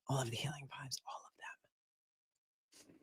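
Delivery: breathy slow whisper of healing vibes; soft whisper. Real, not acted. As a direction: whisper it like a gentle blessing. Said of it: "sending you all of the healing vibes".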